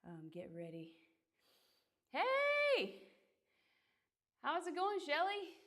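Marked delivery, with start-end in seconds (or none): 2.1-5.6 bright high shout of hello; greeting a chat viewer, excited and loud. Real, not acted. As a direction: call out happily to a friend.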